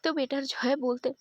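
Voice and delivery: ordinary delivery, nothing marked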